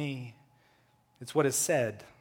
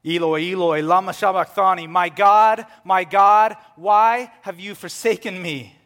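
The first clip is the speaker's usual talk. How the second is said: much louder, higher cry to God; loud anguished cry; 6 semitones higher, 13 dB louder than usual. Acted. Jesus crying out to God from the cross, loud. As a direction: cry it out at full voice. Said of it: "in a loud voice".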